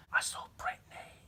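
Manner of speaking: hushed eerie whisper; creepy child. Retold or imitated. Creepy twin in a horror film, eerie whisper.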